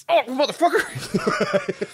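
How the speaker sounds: high, slow, laughing groan; laughing curse. Retold or imitated. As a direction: stretch it out through a laugh. Character drops cigarette, laughing.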